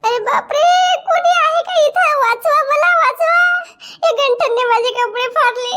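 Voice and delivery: high, loud staged screams for help; hammed-up shouting to trap him; fake cry for help. Wife falsely cries assault to trap Ganthan, staged shouting. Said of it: "असं आता फसवावं लागेल ना तुला".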